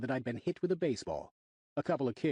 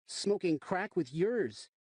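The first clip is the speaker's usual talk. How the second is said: put-on higher, slow smug kid; smug kid retort; 7 semitones higher, much slower than usual. A narrator's reading. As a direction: drag out the insult. Kid's smart retort.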